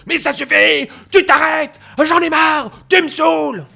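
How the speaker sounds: cri aigu et très fort, exaspéré; Bernard crie. Retold or imitated. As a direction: hurle sur le chien par la fenêtre. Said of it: "on a Bernard qui ouvre la fenêtre". (Bernard hurle, exaspéré, très fort)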